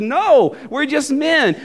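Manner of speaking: high-pitched acted objection; urgent protest. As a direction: refuse the honour emphatically. Apostles protest being worshipped.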